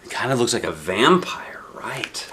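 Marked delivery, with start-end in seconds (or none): 1.7-2.3 breathy whispered question; whispering. Real, not acted. Whispered aside to child.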